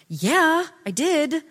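loud, high-pitched condescending yes; stupid idiot tone. Acted. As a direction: answer as if the question is idiotic. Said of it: "the stupid idiot tone".

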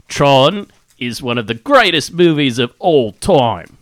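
put-on Aussie accent; Australian accent. Reading Aussie listener's mail on a podcast, mock Australian accent. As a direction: read it as a hammed-up Australian. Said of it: "read it in an Australian accent".

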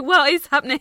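giggling bafflement; laughing disbelief. Real, not acted. Laughing disbelief at time jump.

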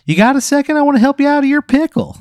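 very high, loud and chirpy; a cheerful little pickle introducing himself; squeaky cartoon pickle. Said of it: "He goes".

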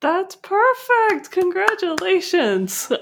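high, slow, gushing praise; excited. Real, not acted. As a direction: beam with delight at the example. Delighted praise of the example.

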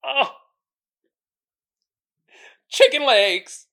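ordinary talk

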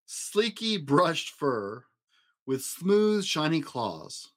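usual speaking voice

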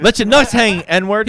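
loud, high mock holler; hammed-up drunken taunt. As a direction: yell it out as a rowdy imitation. Re-enacts drunken taunt at stranger.

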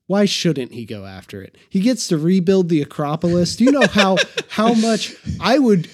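normal talking voice

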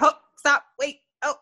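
high, hurried self-reminder to breathe; flustered self-calming. Retold or imitated. Therapist mocking her own flustered self-talk, pitched high. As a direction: talk yourself down mid-argument. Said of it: "you still have to be like".

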